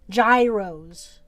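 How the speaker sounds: "Gyros" said with a hard J, JYE-rohz; dad's pronunciation. Retold or imitated. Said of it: "That's how my dad was saying it".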